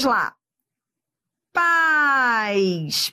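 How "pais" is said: PAIS drawn out as a single gliding syllable; one long syllable. Shown as a demonstration. Teacher warning it is not país, one long syllable. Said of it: "não é país".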